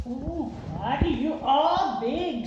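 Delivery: high, slow, amazed and fond; girl greeting the huge dog fondly; fond delight. Acted. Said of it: "said Small".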